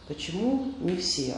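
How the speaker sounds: ordinary talk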